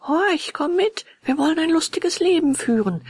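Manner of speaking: high-pitched, eager urging; lively boyish call, pitched well up. A narrator's reading. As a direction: coax a friend to come along. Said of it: "rief Röhrle".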